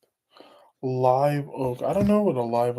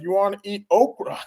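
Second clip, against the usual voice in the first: mock southern lady; 6 semitones higher than usual. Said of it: "a real hardcore southern".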